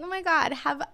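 sweetly fake, hiding contempt; fake cheerful. Wishing a rude customer a great day, fake nice. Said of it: "when a customer is being an asshole".

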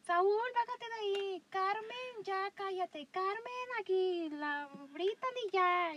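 put-on high, loud, slow yell at kids; neighbor yelling. Neighbor mom yelling at Saúl and Carmen, high and loud. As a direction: holler at kids across the yard. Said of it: "la vecina gritona que nada más está gritando a sus hijos".

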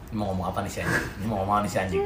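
low, softened aside to oneself; muttered inner thought. Retold or imitated. Friend recalls watching his drunk mate, muttered to himself.

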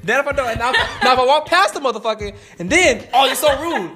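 loud, high-pitched incredulous protest; worked-up, can't-win complaint; exasperated rant. Real, not acted. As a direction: argue it out at the top of your range, half laughing. Exasperated rant about being cussed out.

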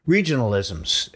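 ordinary talk

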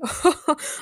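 mock-hearty royal laugh. A narrator's reading. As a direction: laugh grandly at a foe. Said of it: "Ray laughed heartily".

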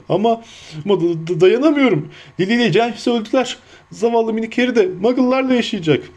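high-pitched, stammering wail of grief; acted tearful giant, voice pushed high; sobbing. A narrator's reading. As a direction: blubber through it, tripping on the words. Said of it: "diye hıçkırdı".

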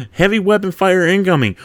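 lightly shouted battlefield alert; shouted warning. A narrator's reading. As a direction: call out incoming fire to the squad. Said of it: "one of the Marines shouted".

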